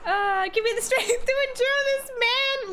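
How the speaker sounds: mock pious monk. Mock monk asking strength to endure the old man. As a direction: pray for patience with a grin.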